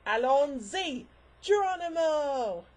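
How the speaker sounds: loud, high, drawn-out battle cry; excited Doctor cry. Pretend. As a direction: throw it out with glee. String of Doctor catchphrases.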